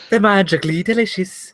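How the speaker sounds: high mock Irish accent. Co-host quoting a cereal slogan, mock Irish accent.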